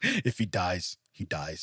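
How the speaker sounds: low, soft, cold delivery; deadpan threat from a boxing film; a hint of a Russian boxer; menacing movie villain quote.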